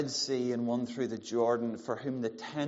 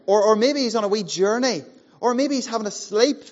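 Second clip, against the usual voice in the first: louder, higher mocking taunt; loud mockery; 8 semitones higher, 8 dB louder than usual. Elijah taunting Baal's prophets, loud and mocking. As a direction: jeer at them as if their god dozed off. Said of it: "Elijah mocked them".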